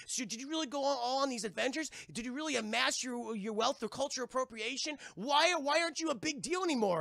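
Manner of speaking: put-on high-pitched kids' questioning; inquisitive boys. Eager boys fire questions at uncle.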